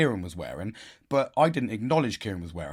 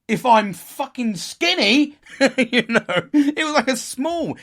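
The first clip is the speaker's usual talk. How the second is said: laughing; 12 semitones higher, 8 dB louder, slower than usual. Real, not acted. Laughing while telling it.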